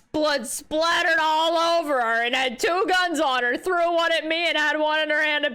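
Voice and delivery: loud, sustained mock cheering; mock hype. Player ridicules the claim that Gina had two guns, mock hype. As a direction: hype it up sarcastically, stretching the final words.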